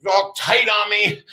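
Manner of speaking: normal talking voice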